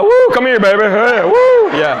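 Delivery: put-on loud, high whooping woo, come here baby; mock party whoop. Comic teasing a couple in the crowd, hammed-up high whoops. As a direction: ham up a drunk New Year's Eve come-on. Mock New Year's Eve passion.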